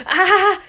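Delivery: high, very loud shriek; horrified scream. A narrator's reading. Boy touches something wet and alive, screaming in horror. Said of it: "schrie er entsetzt".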